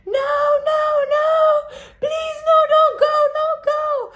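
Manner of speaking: high, loud pleading screams; shrieking no, please don't go; panicked yelling. Retold or imitated. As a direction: scream at him to stop. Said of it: "yelling at him".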